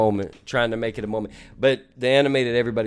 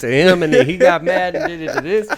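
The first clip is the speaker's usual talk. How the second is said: higher, animated voice of a friend reliving it; excited reminiscing; 6 semitones higher, 4 dB louder than usual. Acted. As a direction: act out eagerly recalling a shared game memory.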